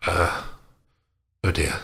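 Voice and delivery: usual speaking voice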